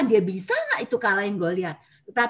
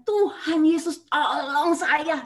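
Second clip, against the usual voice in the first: retold cry for help; 3 semitones higher, much slower than usual. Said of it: "sampai nggak bisa keluar suara".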